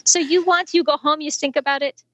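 put-on high French-accented patter, dropping at the end; playful imitated French accent in halting English; broken English, French accent. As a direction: deliver the punchline as a Frenchman. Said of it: "kind of in his broken f-, English".

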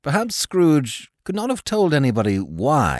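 usual speaking voice

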